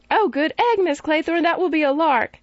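put-on high, thrilled child's voice; a small boy cheering his governess's plan; delighted little boy. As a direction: bubble over like an excited schoolboy.

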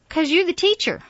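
high, loud comeback; sharp retort. A narrator's reading. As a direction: fire it back with impatience. Aunt snaps back in quarrel.

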